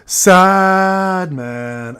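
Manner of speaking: SAD held very long, falling away into "man"; drawn-out sad pronunciation. Shown as a demonstration. Asking a chatter if the name must be said this way, exaggeratedly drawn out. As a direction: drag "sad" out like a mournful moan. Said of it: "I have to say it like this every time".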